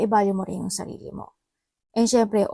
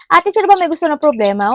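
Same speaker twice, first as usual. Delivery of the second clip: brisk, higher, louder quote of a little sister; a younger sister asking the fortune teller, quick and loud; quoted younger sibling; 6 semitones higher, 9 dB louder, faster than usual. Retold or imitated. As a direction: toss off the question like a bratty younger sibling. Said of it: "sabi niya".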